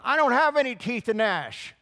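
put-on high, loud toothless old woman; old lady yelling. Old professor yells back at the street preacher, high shout. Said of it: "So she yells".

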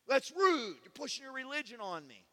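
high, loud offended yell; yelling neighbor. Retold or imitated. Old neighbor yelling at him in the laundromat, high and loud. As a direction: shout the accusation indignantly. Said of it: "like, yelling at me in the laundromat".

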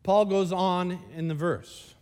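normal talking voice

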